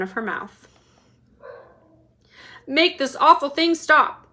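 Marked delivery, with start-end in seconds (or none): 2.7-4.3 higher, louder demand; ordering. A narrator's reading. Mister TV demanding Wonka stop, angry order. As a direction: bark the order. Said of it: "ordered Mister T V".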